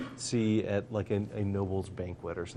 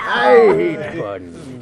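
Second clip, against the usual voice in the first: loud, slow, laughing Smitty voice; old Smitty; 8 semitones higher, 15 dB louder, much slower than usual. Acted. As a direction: bellow it slowly through a laugh. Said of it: "you hear old Smitty say".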